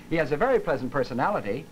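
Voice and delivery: PERSONALITY ending on a slight rise, as if "but" follows; slight final rise. Shown as a demonstration. Lecturer showing praise with a "but" coming, voice rising slightly. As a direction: let "personality" lift a little at the end. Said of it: "allow my voice to rise slightly".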